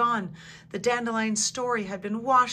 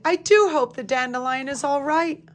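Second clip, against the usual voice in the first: worried, higher and louder; worried cry; 6 semitones higher, 7 dB louder than usual. A narrator's reading. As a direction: fret aloud for a friend. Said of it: "Sparrow cried".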